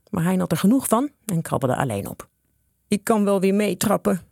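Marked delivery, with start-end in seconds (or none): 2.9-4.2 a touch of a groan. A narrator's reading. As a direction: say it with a slight groan. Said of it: "kreunde hij". (licht kreunend)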